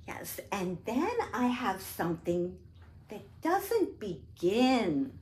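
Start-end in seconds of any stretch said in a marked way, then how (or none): none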